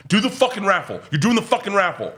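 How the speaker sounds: imitated high-pitched, insistent badgering; aggressive demand. Wait staff demanding the comic do their raffle, aggressive. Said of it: "it got aggressive, though".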